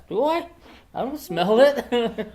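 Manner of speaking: high, slow feigned innocence; playing innocent. Boy to dormitory staff, high slow feigned innocence. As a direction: act dumb and blameless. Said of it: "trying to be innocent".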